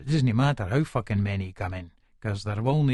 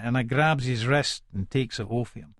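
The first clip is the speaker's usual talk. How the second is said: plain reading voice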